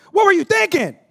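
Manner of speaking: mock yell of an exasperated father; mock angry God. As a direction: blast the question like a fed-up father. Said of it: "He don't look at us and go".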